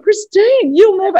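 high, loud horrified mother; mum's shocked outburst. Retold or imitated. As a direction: blurt it out like a shocked Greek mum. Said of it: "my mum's like".

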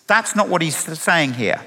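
ordinary delivery

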